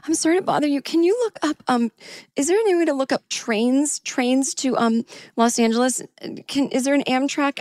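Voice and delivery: high, apologetic asking; timid plea. Retold or imitated. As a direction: beg the agent politely, sounding small. Timid plea to the gate agent.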